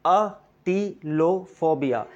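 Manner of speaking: atilophobia drawn out very slowly, syllable by syllable; slow syllable demo. Shown as a demonstration. Teacher slowing atilophobia down for learners. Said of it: "how to pronounce this word".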